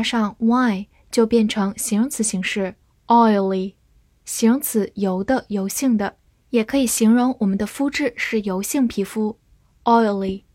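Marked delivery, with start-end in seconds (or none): none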